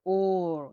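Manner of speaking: ওর, punching the ও a bit harder. Shown as a demonstration. Teacher repeats ওর to show the stronger ও. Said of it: "মানে ওটা একটু জোরে উচ্চারণ হবে".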